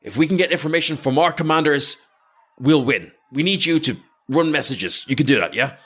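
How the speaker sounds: lightly acted battlefield pep talk; commander Zoran briefing. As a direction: urge the runner on, point by point. Game master plays commander briefing runner.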